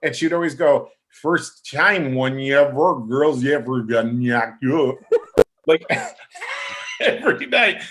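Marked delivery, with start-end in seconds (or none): 1.1-5.0 imitating a thick Russian accent, mangled line; mock Russian diva garbling the words. Actor imitating Russian Mama Morton's line, heavy Russian accent. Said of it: "it would sound like a warped record". 5.0-7.8 laughing. Real, not acted. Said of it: "laughing through the retelling".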